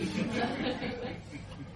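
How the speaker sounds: high breathy cackle; little mad-scientist laugh; soft old woman cackle. Acted.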